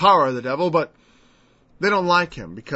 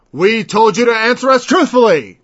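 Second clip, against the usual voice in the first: loud, higher, indignant rebuke; angry demand; 6 semitones higher, 8 dB louder than usual. Retold or imitated. Council demanding Jesus answer truthfully, loud angry rebuke. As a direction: snap it at the accused like a judge.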